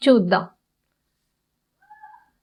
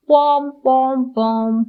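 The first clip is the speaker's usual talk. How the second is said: long, level-toned honks; imitated van horn. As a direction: sound the horn with the voice. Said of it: "horn". (Van horn మోతను అనుకరిస్తూ)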